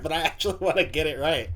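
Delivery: ordinary delivery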